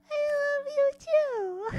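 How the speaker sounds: high, held sing-song reply; high melting reply. Retold or imitated. She coos love back to Goji. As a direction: melt and coo it back.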